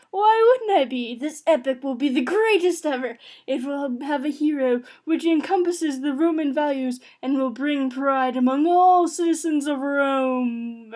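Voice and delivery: loud, proud imperial proclamation; grandiose emperor. Acted. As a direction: boom it out like a vain emperor. Emperor insists on grand epic.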